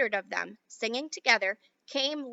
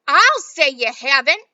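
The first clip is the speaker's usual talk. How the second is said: loud, slow bitter cry; mother cries out at Wonka; angry cry; 3 semitones higher, 14 dB louder, slower than usual. Acted. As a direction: throw it back at him. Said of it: "cried Missus Beauregard".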